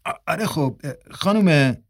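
hesitant, stammering start; flustered. A narrator's reading. Dalen caught with the book by Mrs. Marsten, flustered.

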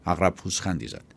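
usual speaking voice

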